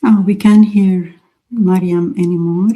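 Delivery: normal talking voice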